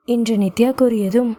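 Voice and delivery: ordinary delivery, nothing marked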